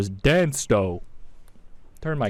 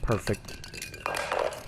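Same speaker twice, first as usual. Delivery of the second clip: whispered aside; 5 semitones lower, 6 dB quieter than usual. Real, not acted. Muted sarcastic aside.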